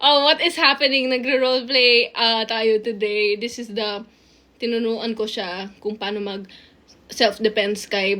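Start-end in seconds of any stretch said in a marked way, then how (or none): none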